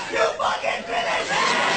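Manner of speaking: slow, rasped-out shout; frenzied fan roar. Retold or imitated. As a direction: roar like a wrestling fan demanding the finish. Wrestling fan egging her on.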